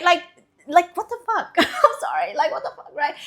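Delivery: laughing loudly through the words. Real, not acted. Laughing at her own rant about dressing for others. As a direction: burst out laughing mid-rant.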